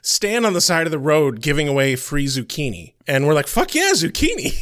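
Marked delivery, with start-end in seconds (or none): 3.5-4.5 high, whooping delight at a freebie; mock elated cheer. Traveler retells spotting free zucchini, high excited cheer. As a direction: cheer it in a much higher voice.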